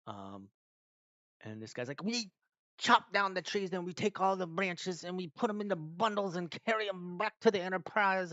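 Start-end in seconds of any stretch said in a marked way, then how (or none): 2.0-8.3 mock captain yelling, higher and louder; overbearing player shouting orders at the table. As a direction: bellow the plan at the whole table. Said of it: "shouting everything".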